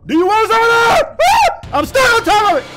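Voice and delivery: very high, loud screaming panic; panicked shouting. Real, not acted. Gamer stuck with monster on him, panicked shouting. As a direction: scream it while under attack.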